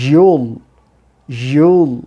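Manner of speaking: emphatic drawn-out you'll; consonant y. Shown as a demonstration. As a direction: model you'll firmly with a strong y glide. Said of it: "sound হবে এরকম".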